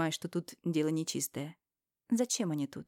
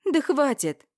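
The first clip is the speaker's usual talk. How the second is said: sudden high, loud outburst; flaring up; 8 semitones higher, 8 dB louder, slower than usual. Acted. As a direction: snap out in a flash of anger. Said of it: "Вспыхиваю". (вспышка гнева, выше и громче)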